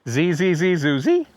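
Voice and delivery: imitating a warbler's song in words; quick even zees, last one popped up; birdsong mnemonic. Shown as a demonstration. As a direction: chant the buzzy bird call with a lift on the last note. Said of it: "a very easy to remember song".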